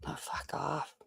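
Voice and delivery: under-the-breath annoyed whisper; real irritated whisper; whispered curse. Real, not acted. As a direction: mutter it off-mic, fed up. Annoyed aside while clip plays.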